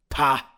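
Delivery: loud, high "pah"; annoyed scoff. A narrator's reading. Dracula scoffs at the heroes' jokes, annoyed scoff. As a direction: spit out a scoff. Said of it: "rattled with profound annoyance".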